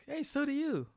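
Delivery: very high, put-on retort; playful imitation of a sweet retort; mock-cheery comeback. Acts out a cheeky comeback.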